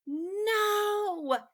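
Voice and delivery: a long high screaming no. Acted. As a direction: scream at the wolf to stop.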